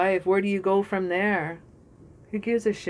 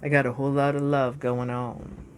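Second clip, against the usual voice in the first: cool smooth-talker; 5 semitones lower than usual. Retold or imitated. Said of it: "the Emperor says".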